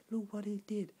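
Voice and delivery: loud, high-pitched accusing cry; mock screaming. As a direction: act out a wife crying out for witnesses. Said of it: "she start to scream".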